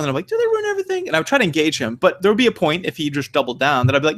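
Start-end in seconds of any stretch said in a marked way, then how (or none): 0.3-1.1 much higher mock-disbelief question; answering the bigot with a sarcastic high-pitched question; incredulous retort. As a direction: toss it back with exaggerated disbelief.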